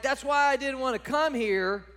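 loud, high whine at God; a sulky prophet griping at God; exasperated complaint. Acted. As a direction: throw the complaint right back at him. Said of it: "Jonah is like".

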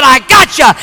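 loud, high gotcha; gloating neighbor. Retold or imitated. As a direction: crow over catching her out. Neighbor springs his trap.

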